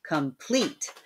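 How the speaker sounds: "Complete" with the second half stressed; second-syllable stress. Shown as a demonstration. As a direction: lean on the end of "Complete". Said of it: "second half of the word is getting the stress".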